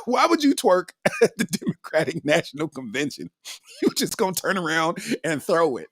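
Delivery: giggling while talking; can't stop laughing; words broken by real laughter; laughing through words. Real, not acted. Laughing at twerking story.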